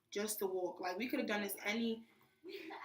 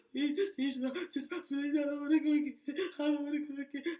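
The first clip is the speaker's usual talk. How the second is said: high, loud whimpering plea; imitating crying; 5 semitones higher, 3 dB louder than usual. Scared recruit begging drill sergeant on the tower, high teary pleading. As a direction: replay the tearful begging, voice pitched up. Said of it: "And I'm crying".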